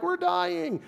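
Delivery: high, squeaky mock terror; mock panic. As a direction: play the frightened disciples in the storm. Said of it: "they're like".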